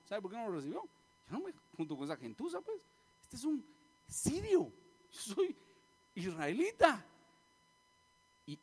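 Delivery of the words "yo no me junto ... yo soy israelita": mock haughty scorn, pitch jumping up on the insult; indignant disdain. Preacher voicing why the Syrian was snubbed, haughty disdain. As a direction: act out offended pride, rising high.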